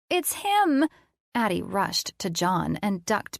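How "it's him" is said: put-on high, loud child's squeal of delight. Little boy squealing at the mad scientist. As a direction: shriek it like a thrilled little kid. Kids screeching at Colin.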